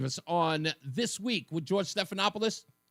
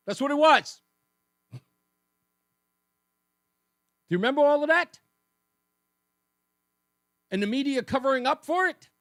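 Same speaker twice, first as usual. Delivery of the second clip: heated; 7 semitones higher, 6 dB louder than usual. Real, not acted. Host building outrage.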